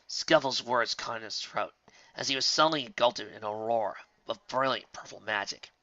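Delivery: ordinary delivery, nothing marked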